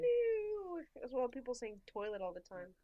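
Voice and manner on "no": very high, loud mock wail; sustained high-pitched cry of refusal; drawn-out protest. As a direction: whine a long playful refusal. Protests having to get used to it.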